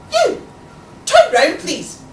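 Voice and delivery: loud, high teacherly command; sharp classroom authority; most assertive voice. A narrator's reading. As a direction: order a pupil firmly, punching the first words. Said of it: "in my most assertive voice".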